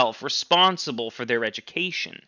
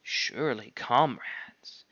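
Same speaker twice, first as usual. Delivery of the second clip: mildly pleading, softer; 2 semitones lower, 8 dB quieter, slower than usual. Acted. Squealer pleading with the animals, softer. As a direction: plead gently. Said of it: "almost pleadingly".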